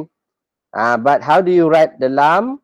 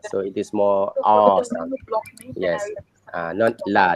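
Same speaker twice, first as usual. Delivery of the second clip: ordinary talk, nothing marked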